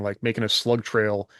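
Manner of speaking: normal talking voice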